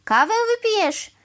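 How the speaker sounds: high, loud, climbing string of questions; indignant rant. A narrator's reading. Crow insisting she use her tree house, indignant. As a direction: reel off objections in agitation.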